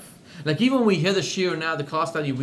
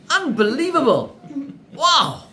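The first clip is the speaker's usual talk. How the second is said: loud, high burst of amazement; amazed; 9 semitones higher, 6 dB louder, slower than usual. Acted. Husband marvels aloud at the rabbi's scheme, loud and high. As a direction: cry out in wonder at the plan.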